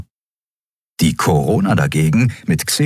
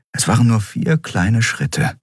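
ordinary delivery

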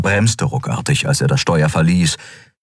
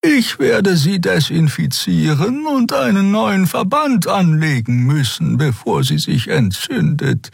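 concerned female figure; 12 semitones higher than usual. Acted. Xea tells Kero his wound needs dressing, high and concerned.

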